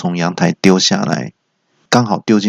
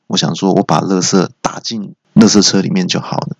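ordinary talk, nothing marked

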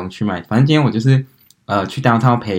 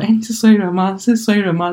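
retold excited call; 11 semitones higher than usual. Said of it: "他们就大叫说".

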